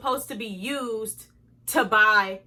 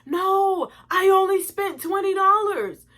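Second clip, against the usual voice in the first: high, whiny mock voice of a lying boyfriend; mocking boyfriend's lie; 8 semitones higher, 3 dB louder than usual. Girlfriend mocking her boyfriend's excuse to her viewers, high mock whine. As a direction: play innocent in a high put-on whine. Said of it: "this man proceeds to lie in my face".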